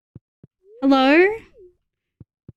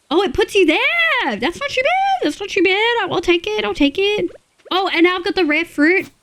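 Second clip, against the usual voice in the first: much higher, pleased and bright; pleased surprise; 9 semitones higher than usual. Real, not acted. Player pleased where the teleport lands, much higher voice. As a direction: sing out happy acceptance.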